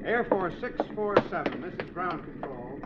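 faint, soft ground-control call; distant radio call. Acted. Controller still calling the pilot, faint distant voice. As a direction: keep calling the plane from afar.

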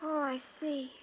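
flat and quiet, no enthusiasm; dull, subdued reply; unexcited. Acted. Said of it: "You don't sound very excited about it".

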